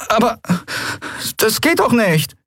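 high, hesitant protest; helpless dismay. A narrator's reading. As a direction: plead in a small high voice, looking for help. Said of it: "sah sich hilfesuchend um".

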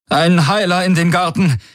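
a frightened cry for help over the comm; trembling call. A narrator's reading. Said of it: "rief sie mit zitternder Stimme".

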